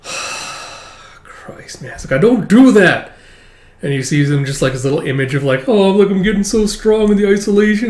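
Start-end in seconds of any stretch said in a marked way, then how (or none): 2.2-3.1 higher, louder prim denial; a hammed-up prim protest; mock protest. Yor protesting. 5.7-7.9 higher, smug self-praise; a lightly mocking smug voice; mock boast. Mocking his delusion.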